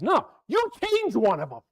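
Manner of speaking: high, loud comic outburst; yelling at God. Retold or imitated. Noah loses it with God, comic yelling. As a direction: play a fed-up man yelling back. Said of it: "He's yelling at God".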